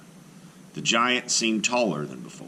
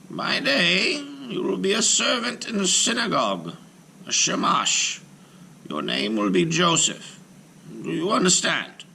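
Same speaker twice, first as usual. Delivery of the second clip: high, firm voice of a stern rabbi; 14 semitones higher than usual. Acted. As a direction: give the giant his orders one by one. Rabbi instructs golem.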